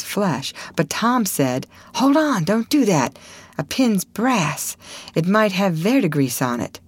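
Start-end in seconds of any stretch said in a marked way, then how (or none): 1.9-6.8 put-on boy's higher-pitched urgent caution; urgent warning. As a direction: cut in to stop your friend. Tom urgently stops Huck's pin prick.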